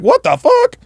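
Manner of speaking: high-pitched mimicked disbelief; squeaky mock shock; mock incredulity. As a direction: imitate girls balking, voice shooting way up. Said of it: "the girls were like".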